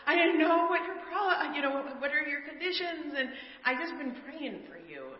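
high, loud, tearful rush of words; retold bawling. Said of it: "I'm, like, bawling".

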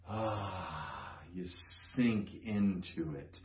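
soft, sinking sigh into the words; sinking in contentedly. Pretend. Preacher miming sinking into a cozy sweatshirt, softly. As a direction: let yourself melt into the phrase.

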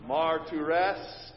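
"Martyres" said as mar-tu-res, the R's sounded; Greek martyres. Shown as a demonstration. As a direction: pronounce "Martyres" slowly, syllable by syllable. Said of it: "say it one more time".

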